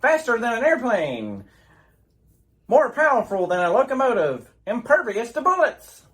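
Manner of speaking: grand announcer read of the Superman opening; old radio announcer. Acted. Radio announcer presenting Superman to boys and girls. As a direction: sell it like a vintage radio show.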